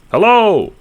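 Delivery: loud, high call across a field. A narrator's reading. Johnny hailing a distant figure, loud call. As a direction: call out to someone far away. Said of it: "you call across the field".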